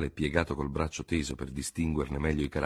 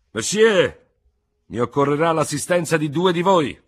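much higher and loud summons; commanding call; 11 semitones higher, 9 dB louder than usual. Acted. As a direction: call out for volunteers. General calls for two seconds.

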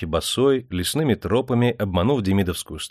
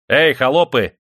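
loud, higher-pitched call to servants; shouting; 4 semitones higher, 6 dB louder than usual. A narrator's reading. Master summons his servants, shouting. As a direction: holler for the servants. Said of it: "закричал он".

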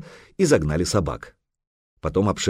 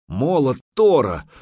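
loud, higher viking roar; 7 semitones higher, 3 dB louder than usual. Acted. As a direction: bellow like an alarmed viking. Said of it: "взревел медвежья лапа".

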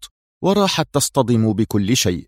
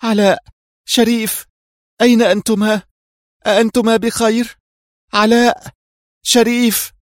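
high, urgent cries of alarm; panicked calling; 11 semitones higher, 3 dB louder than usual. A narrator's reading. Reham calls to Alaa and Sherif after the crash, panicked. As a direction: call out in panic for missing companions. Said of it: "وهي تهتف في هلع".

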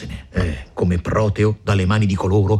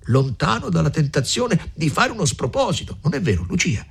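lightly voiced older woman pressing her plan; a hint of a shrewd mother urging them on; Agnese, eager; 7 semitones higher than usual. A narrator's reading. Mother urging the young couple, lightly voiced.

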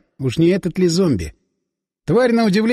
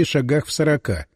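plain reading voice

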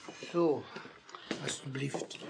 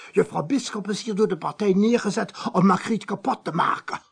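louder and higher, working up to fury; rising zealous outrage, much louder; agitated accusation; 4 semitones higher, 10 dB louder than usual. Acted. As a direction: rail against the party, building. Priest's rising accusation of the party.

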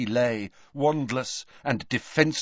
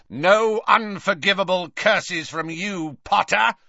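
raised, higher shouted taunt; Snape; 5 semitones higher than usual. A narrator's reading. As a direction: shout it over the noise of flames. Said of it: "he shouted over the rushing".

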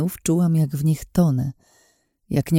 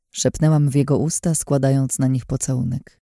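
usual speaking voice

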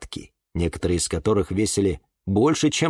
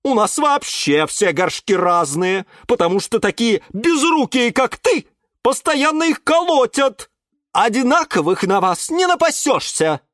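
higher, lightly voiced scolding caretaker; scolding aunt Glasha; 11 semitones higher, 4 dB louder than usual. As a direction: nag him like a fed-up caretaker. (тётя Глаша ворчит на Юру, слегка сыгранно)

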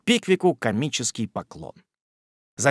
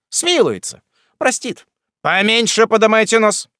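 unruffled mockery; 8 semitones higher, 5 dB louder than usual. A narrator's reading. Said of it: "с невозмутимым спокойствием".

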